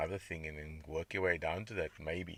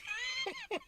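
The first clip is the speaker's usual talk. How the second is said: burst of laughter; 4 dB quieter than usual. Real, not acted. Laughs at own joke.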